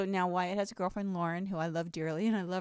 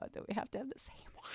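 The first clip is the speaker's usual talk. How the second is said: whisper; 2 semitones higher, 11 dB quieter, faster than usual. Retold or imitated. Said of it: "And I go".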